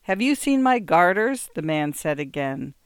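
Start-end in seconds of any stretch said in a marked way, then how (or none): 0.0-1.5 raised, higher voice on a repeated question; calling out insistently. A narrator's reading. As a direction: insist on an answer, louder. Man repeats his unanswered question.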